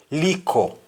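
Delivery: "liko" with a k in place of the t; Jamaican patois. Shown as a demonstration. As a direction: say "liko" for little. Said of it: "Instead of little, you say".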